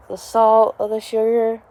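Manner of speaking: normal talking voice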